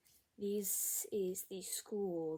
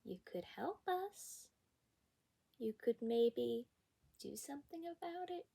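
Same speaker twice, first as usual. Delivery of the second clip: pointed mock suggestion; 5 semitones higher than usual. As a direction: spell out the obvious as a tentative question. Imagined retort to unhelpful teacher.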